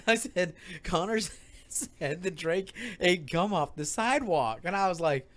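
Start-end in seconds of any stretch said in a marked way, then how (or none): 0.8-4.6 slow, softer, breaking into laughter; laughing retelling. Retold or imitated. Repeating Connor's claim to Will, laughing retelling. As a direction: struggle through it with laughs.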